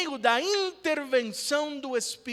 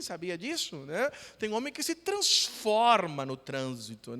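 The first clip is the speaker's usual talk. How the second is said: ordinary delivery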